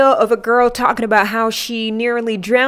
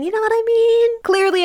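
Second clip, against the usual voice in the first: high squeaky voice; 13 semitones higher than usual. Acted. Asks once, then repeats it squeaky.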